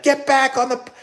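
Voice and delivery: loud, very high mock yell; retold yelling. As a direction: re-enact shouting urgently at a runner. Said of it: "so now I'm yelling at him".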